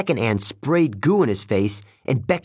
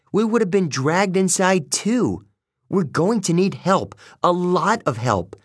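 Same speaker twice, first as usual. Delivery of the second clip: raised pitch, forceful explaining; urgent insistence; 7 semitones higher than usual. A narrator's reading. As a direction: drive the danger home, then order action. Annabeth shakes Selena, urgently explaining.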